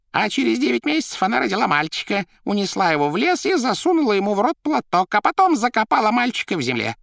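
very high, loud storyteller voice; Коровьев рассказывает. Acted. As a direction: relish the grim story. Коровьев рассказывает историю Фриды. (очень высоким, громким голосом)

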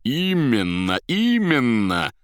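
loud, high, drawn-out exclamation; delighted cry. A narrator's reading. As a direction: cry it out in delight, stretching the word. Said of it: "закричал он". (восторженный протяжный крик)

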